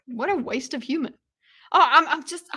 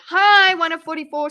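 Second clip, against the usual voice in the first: yelled hi; 5 semitones higher, 8 dB louder than usual. Real, not acted. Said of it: "I lost viewers just from yelling".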